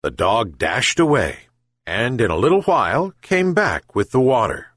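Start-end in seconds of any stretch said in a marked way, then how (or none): none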